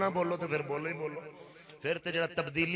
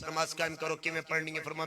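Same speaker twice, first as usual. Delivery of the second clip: normal talking voice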